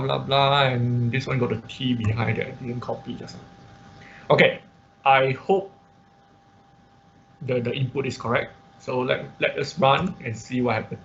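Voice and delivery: ordinary delivery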